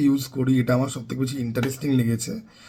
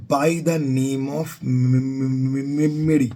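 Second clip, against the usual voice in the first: real stammer, stuck repeatedly on the M of Mary; stuttering; 2 semitones lower, much slower than usual. Real, not acted. Quoting an English oath to viewers, stuttering on Mary. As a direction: stumble over the M several times before getting Mary out. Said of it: "ভাই, এত তোতলাচ্ছি কেন?".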